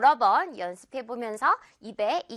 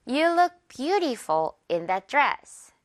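slow demo; 2 semitones higher, much slower than usual. Shown as a demonstration. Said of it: "문장 전체 갈게요".

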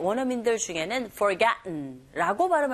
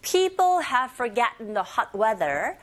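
slow, stretched reading of the sentence; slow sentence model; slower than usual. Shown as a demonstration. Teacher shares the whole sentence for learners to repeat, slowly. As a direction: read slowly, word groups drawn out. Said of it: "sharing the sentence as well".

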